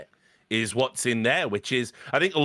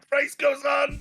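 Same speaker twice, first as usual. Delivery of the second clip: much higher, "goes" pushed up near a shout; hammier mock repeat; 3 dB louder than usual. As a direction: push the repeat even higher and more overwrought. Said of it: "And then he goes".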